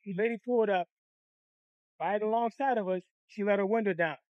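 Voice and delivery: ordinary talk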